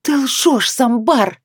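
high, sharp growling accusation; growled through teeth. A narrator's reading. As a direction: spit it out with a growl. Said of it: "с рычанием процедила".

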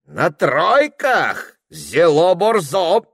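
high, loud, drawn-out cry; merry exclamation. A narrator's reading. Merry priest welcoming the troika idea, exclaiming. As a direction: cry out in slow, loud delight. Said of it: "вскрикнул весёлый отец Ипат".

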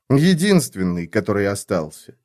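usual speaking voice

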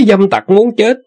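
high shrieking curse; furious outburst. A narrator's reading. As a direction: spit out the curse in fury. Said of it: "buộc miệng mắng".

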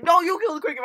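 put-on high, loud childish comeback; panicked bickering. Max refusing to deal with the cricket, high loud retort. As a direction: argue back in mock panic. Imitates Max arguing back.

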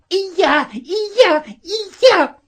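very high, shrieky society lady; hysterical squeal. A narrator's reading. Lady kicking the fallen boy, squealing hysterically. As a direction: squeal it frantically, far up in pitch. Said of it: "истерично взвизгивала".

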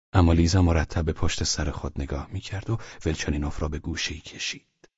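ordinary delivery

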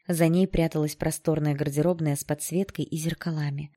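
plain reading voice

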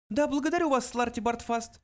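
high mouse voice, offhand; careless. A narrator's reading. Mouse dismisses the old man, careless. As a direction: toss off the thanks in a little high voice. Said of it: "небрежно произнёс Бенджи".

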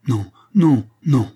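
emphatic refusal, loud first no; exclaimed refusal. A narrator's reading. Daughter rejecting her father's advice, crying out no. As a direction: refuse outright. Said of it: "s'écria-t-elle".

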